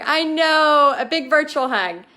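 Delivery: heartfelt, excited agreement; gushing agreement. Real, not acted. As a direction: gush warmly back at a fan. Gushing reply to viewer's hug wish.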